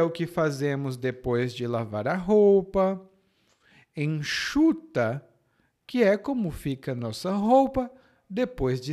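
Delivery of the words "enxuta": "enxuta" slow, X as sh; "enxuta" slowly, with a clear sh; sh sound. Shown as a demonstration. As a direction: model "enxuta" drawn out as en-SHU-ta. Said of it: "o som é de sh".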